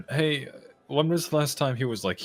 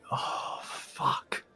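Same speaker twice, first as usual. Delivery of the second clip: whispered curse; 5 dB quieter than usual. Real, not acted. Realizes money stolen.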